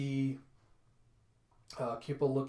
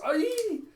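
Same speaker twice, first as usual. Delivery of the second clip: very high, loud squeal; sustained high yelp of fright; startled shriek; 8 dB louder than usual. Acted. Kippa as something grabs her, startled shriek.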